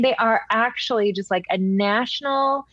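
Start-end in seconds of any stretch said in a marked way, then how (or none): none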